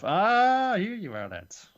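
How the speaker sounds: villain sneering at the trapped lads; smug taunt. Retold or imitated. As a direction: greet them like a cocky villain.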